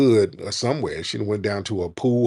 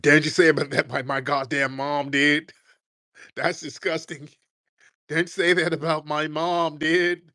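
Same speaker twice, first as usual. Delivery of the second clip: whiny mock offended voice, a bit higher; mocking offended caller; 5 semitones higher than usual. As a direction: parody the upset caller defending his mom. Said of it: "he got real offended by that".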